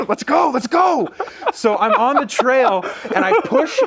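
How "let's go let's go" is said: high, urgent shout; rally yourself with a raised, far higher voice; fired-up rallying cry. Retold or imitated. Hunter setting off after the bull, fired-up shout.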